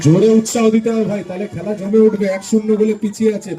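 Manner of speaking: high loud rallying commentary; frantic football commentary. Real, not acted. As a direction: whip up the crowd. Urging crowd, live commentary. (উত্তেজিত football ধারাভাষ্য)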